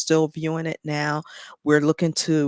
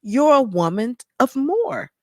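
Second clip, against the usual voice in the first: high, swelling voice full of feeling; moved while testifying, drawing out key words; emotional; 5 semitones higher than usual. Real, not acted. Woman recounting hearing God speak to her, real emotion. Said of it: "I don't know why I get emotional".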